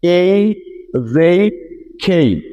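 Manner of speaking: এই, জেই, খেই each with the same e-to-i glide; pattern drill. Shown as a demonstration. Teacher to students, drilling the same glide pattern. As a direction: run the three glides in one pattern. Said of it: "pronunciation গুলো একটু খেয়াল করেন".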